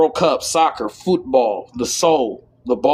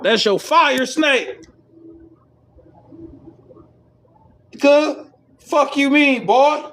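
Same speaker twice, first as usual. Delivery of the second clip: hyped up; 11 semitones higher, slower than usual. Real, not acted. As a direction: preach it with heat. Hyped preaching about hair.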